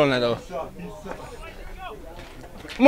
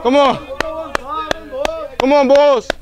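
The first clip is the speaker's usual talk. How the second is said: cheering; 10 semitones higher than usual. Real, not acted. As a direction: keep yelling encouragement. Dugout cheering.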